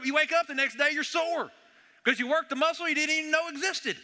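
fervent pulpit encouragement; building fervor. Real, not acted. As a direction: preach it with rising energy. Preaching builds high about workouts.

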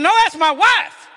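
high, loud retold protest; loud, high, indignant comeback; emphatic correction. Retells indignant correction to woman.

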